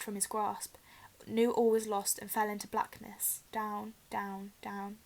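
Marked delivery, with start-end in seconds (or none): 3.5-5.0 fading away. A narrator's reading. Harry falling into blackness.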